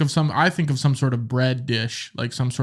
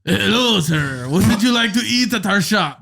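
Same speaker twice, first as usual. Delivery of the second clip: hammed-up accent meant as French, landing Mexican pirate; a higher, overdone shopkeeper accent; botched French accent heard as a Mexican pirate; 5 semitones higher than usual. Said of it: "You went full Mexican pirate".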